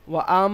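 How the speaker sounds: ওয়া আম with the ম held in the nose; nasal ghunna. Shown as a demonstration. Teacher models ghunna on ওয়া আম. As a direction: let আম ring through the nose. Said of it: "গুণ না করুন".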